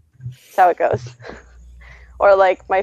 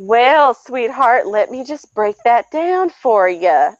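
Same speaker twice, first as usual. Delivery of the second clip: slow, higher-pitched Southern-sweet talking down; slow condescending; 4 semitones higher, much slower than usual. Acted. Explaining to somebody being a moron, slow and condescending. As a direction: stretch it out patronizingly. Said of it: "I need to slow my speech down".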